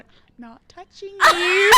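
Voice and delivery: slow, high, loud teasing taunt; loud taunting singsong. Retold or imitated. Sister on the coffee table taunting brother, loud teasing. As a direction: taunt him, drawing it out. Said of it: "I'm standing on our coffee table going".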